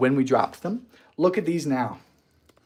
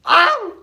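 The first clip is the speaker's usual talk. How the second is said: pained yelp; 6 dB louder than usual. Real, not acted. Sudden pain, laughing.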